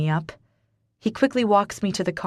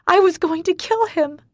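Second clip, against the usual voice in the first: hysterical confession; 11 semitones higher, 5 dB louder than usual. Acted.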